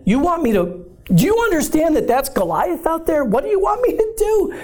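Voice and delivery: shooting up high, voice cracking in disbelief; a terrified David facing Goliath; panicky David. Retold or imitated.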